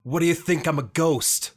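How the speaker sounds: ordinary delivery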